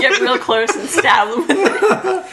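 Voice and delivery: drawn out through laughter; slow laughing. Real, not acted. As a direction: giggle the words out slowly. Laughing at the bayonet joke.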